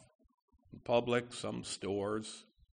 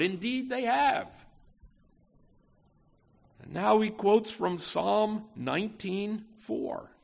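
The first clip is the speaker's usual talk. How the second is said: preaching at full force; 8 semitones higher, 9 dB louder than usual. Acted. Preacher to the congregation, booming full-force preaching. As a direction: lift the rhetorical question to the back pews.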